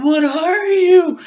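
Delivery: mock-shrieked what are you; high drawn-out quote. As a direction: stretch it out high and squeaky. Acted quote in retold film.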